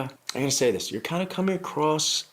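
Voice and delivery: ordinary talk, nothing marked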